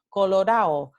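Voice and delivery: "colorao" with no D, ending in a-o; dropped D. Shown as a demonstration. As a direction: stress the "ao" of "colorao", D left out. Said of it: "la D intervocálica se elide".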